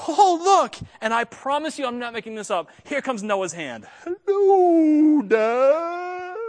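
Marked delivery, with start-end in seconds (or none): 0.1-0.8 delighted high squeal; excited wife. Retold or imitated. Wife showing her husband the moving belly, squealing. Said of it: "and she goes". 4.0-6.4 put-on high, loud little baby greeting. Pastor voicing the unborn baby greeting his dad, high squeak.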